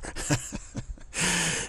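a short breathy laugh; soft laugh. Real, not acted. Teacher laughing at his own joke to listeners, soft laugh. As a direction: chuckle at your own point.

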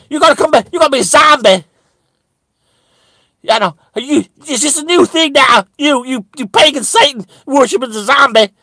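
high-pitched indignant challenge; incredulous accusation. Retold or imitated. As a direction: accuse a friend in mock outrage. Acted argument with pagan friend.

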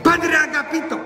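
high-pitched running complaint, easing down; fretful housekeeper. Acted. Housekeeper runs to tell the priest of broken things, fretful calling. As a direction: call out high, then tail off. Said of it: "doña Concha siempre corría y decía".

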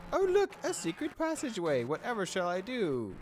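high, hammed-up gasp of wonder; mock surprise. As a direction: play innocent astonishment.

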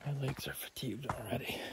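whispering. Real, not acted. Tired after climbing steps.

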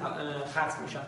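normal talking voice

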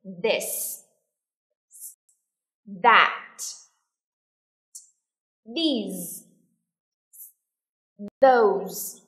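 single words, each set apart for the class; careful teacher's word list, long gaps between; repeat-after-me model. Shown as a demonstration. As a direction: model "This", "That", "These", "Those" with falling tone. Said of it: "Everybody listen and repeat after me".

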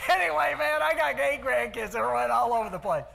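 high, loud words through real laughter; laughing. Real, not acted. Coach laughs at his grandson's joke while telling the crowd. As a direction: still cracking up as he talks.

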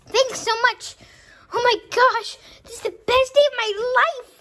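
high, loud, gushing excitement; overjoyed thanks. Acted. Bonnie thanking them for presents, overjoyed. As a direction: squeal with gratitude over the gift. Character receives dream gift, overjoyed.

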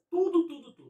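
insistent repeated emphasis; emphatic repetition. Real, not acted. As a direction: hammer home TUDO, TUDO, TUDO. Stressing that presidents know everything.

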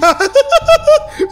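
high-pitched giggle; a squeaky little laugh. Real, not acted. Gamer laughing at the real villains, high giggle.

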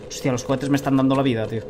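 usual speaking voice

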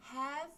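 "have" lifted high and loud on its own; isolated have. Shown as a demonstration. Teacher isolating have before explaining present perfect. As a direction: pull out "have" clearly and rising. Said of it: "have 피피".

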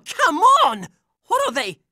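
usual speaking voice

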